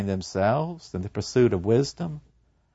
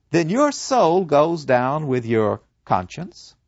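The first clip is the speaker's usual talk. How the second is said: usual speaking voice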